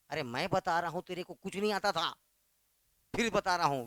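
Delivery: usual speaking voice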